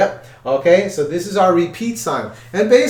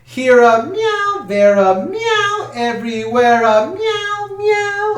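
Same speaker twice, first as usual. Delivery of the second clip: high meowing sung words, much higher; cat impression; 12 semitones higher, slower than usual. Shown as a demonstration. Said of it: "that's my best cat impression".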